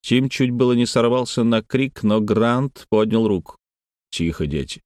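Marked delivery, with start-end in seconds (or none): 4.1-4.8 lower, softer command; low, subdued authority; hushed order. A narrator's reading. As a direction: quietly order children to hush. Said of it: "приказал он".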